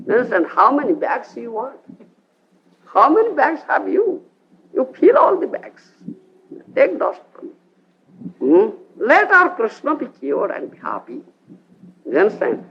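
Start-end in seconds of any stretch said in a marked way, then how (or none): 0.5-11.2 higher, gushing and eager; generous gopi. Acted. A gopi urging Narada to take as much dust as he wants, high and eager. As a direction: fill every bag, take it all.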